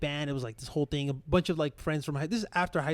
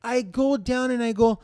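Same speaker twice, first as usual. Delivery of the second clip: normal talking voice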